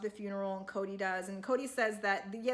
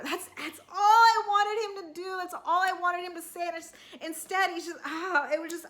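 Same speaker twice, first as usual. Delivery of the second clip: high, loud, choked-up outburst; upset, tearful; 7 semitones higher, 6 dB louder than usual. Real, not acted. Fan aching for a hurt family, tearful and upset. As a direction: let it spill out, near tears and pitched up. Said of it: "I am sensitive too".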